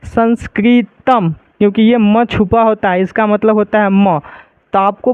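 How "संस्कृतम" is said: संस्कृतम read out slowly, ending firmly on म; slow reading demo. Shown as a demonstration. Teacher answering her own question for the class, slow and clear. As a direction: say संस्कृतम slowly, landing on the final म. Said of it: "इसको हम क्या पढ़ेंगे".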